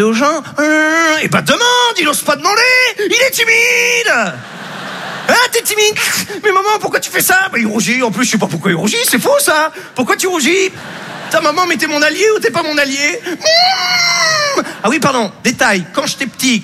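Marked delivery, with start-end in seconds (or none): 2.0-7.5 a mother mocking her shy son in front of guests. Mère qui moque son fils. 9.1-10.7 mocking mother. Mère qui moque son fils. 11.3-14.6 put-on a hurt child wailing at his mother; whining child. Enfant contrarié qui pleurniche.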